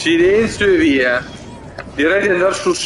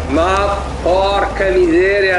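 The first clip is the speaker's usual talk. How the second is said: ordinary talk, nothing marked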